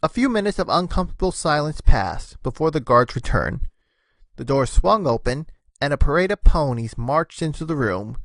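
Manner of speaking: usual speaking voice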